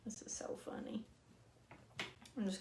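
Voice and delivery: soft whisper, amused; whispered aside. Real, not acted. Whispering to herself as the napkin tears. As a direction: breathe it out as a private aside. Laughing at her napkin mistake.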